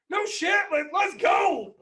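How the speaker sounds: loud, high shout, the last words drawn out slowly; thrilled shout. Retold or imitated. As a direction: cheer like a proud dad celebrating. Dad thrilled at daughter's bargain.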